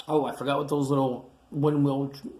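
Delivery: plain reading voice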